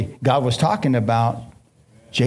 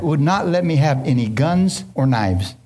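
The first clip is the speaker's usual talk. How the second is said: ordinary delivery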